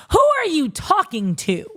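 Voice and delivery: high, slow and indignant, calling her out. Real, not acted. Host scolding Renee through the screen, high and slow. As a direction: demand it like she crossed a line.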